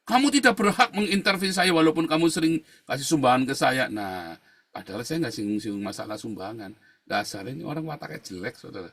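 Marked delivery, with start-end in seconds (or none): 0.1-3.9 loud, high-pitched acted outburst; indignant rebuke. Preacher retelling the man's objection to his interference, outraged. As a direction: imitate an outraged man laying down limits. Acted quote from retold quarrel.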